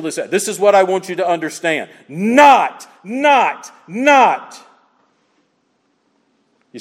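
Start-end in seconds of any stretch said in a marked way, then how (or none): 2.1-4.6 not punched high and loud each time; emphatic not. Shown as a demonstration. Preacher to congregation, repeating not with hammering stress. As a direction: hammer the word not three times. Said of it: "Emphatic means this is important".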